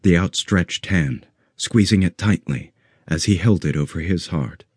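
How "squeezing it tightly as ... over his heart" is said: plain reading voice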